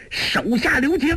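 high-pitched urgent cry; shouted plea. A narrator's reading. Ouyang Ke calls for mercy as the palm falls, urgent high shout. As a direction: cry out high to stop a blow. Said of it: "欧阳克大叫".

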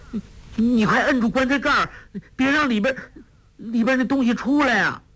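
high, fast, panicked pleading; terrified girl. A narrator's reading. 黄蓉 begs 郭靖 to hold the lid, panicked.

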